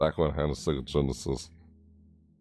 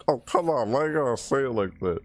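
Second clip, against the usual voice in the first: high-pitched mock complaint; retold exasperation; 9 semitones higher than usual. Speaker replaying an argument with a friend, exasperated protest. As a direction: whine back in protest, pitch well up. Retells complaint to the other person.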